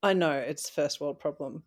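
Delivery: usual speaking voice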